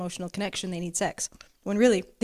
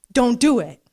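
emphatic command; 4 semitones higher, 9 dB louder, slower than usual. Acted.

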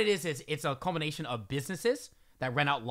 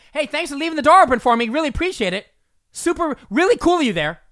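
loud, higher, fast mock-grateful; loud sarcastic thanks; 9 semitones higher, 11 dB louder than usual. Calling up to the woman on the stairs, loud and sarcastic. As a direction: shout fake gratitude at her back. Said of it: "real sarcastic-, sarcastically, really loud".

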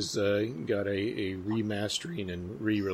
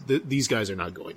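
usual speaking voice

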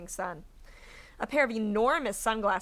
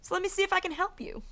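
lightly voiced villain; 10 semitones higher than usual. A narrator's reading. Olaf voiced to a confused elder.

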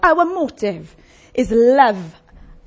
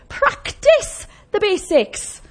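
"Practice the basics" stretched very slowly, higher; slow emphasis; 8 semitones higher, much slower than usual. Shown as a demonstration. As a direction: lean on every word of "Practice the basics". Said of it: "Do the basics".

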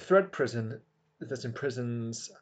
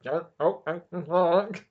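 [name]; 3 semitones higher, slower than usual. Retold or imitated. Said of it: "do an impression of [name]".

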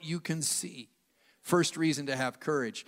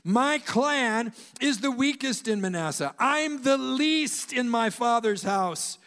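a touch higher, whiny excuse-maker; Gideon making excuses; 6 semitones higher, 3 dB louder, slower than usual. Acted. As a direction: plead weakness like a reluctant hero. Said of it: "making excuses".

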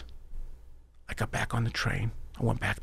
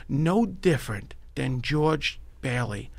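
normal talking voice

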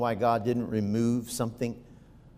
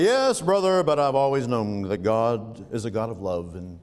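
slow, lower, syrupy pious voice; a hammed-up holier-than-thou brother; mock pious churchgoer; 3 semitones lower than usual. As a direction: mimic the smug saint in the pew. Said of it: "And all will say".

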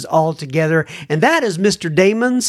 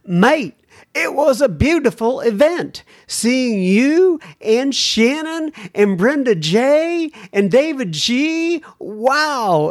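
higher, drawn-out mock-London lilt; put-on London accent; 6 semitones higher, slower than usual. UK listener recalling the live event, put-on London accent. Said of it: "the accent I'm putting on it now".

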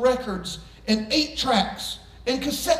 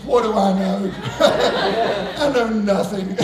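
normal talking voice

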